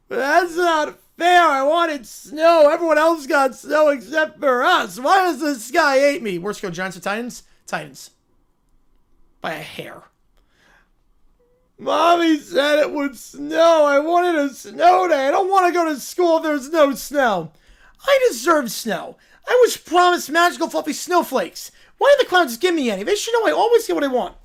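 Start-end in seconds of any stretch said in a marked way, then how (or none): 0.1-6.4 put-on whiny upset kid. Spoiled kid whining about no snow, loud whine. 11.8-24.4 put-on whiny spoiled kid. Bratty kid complaining the clouds gave no snow, loud whine.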